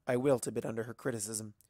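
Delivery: usual speaking voice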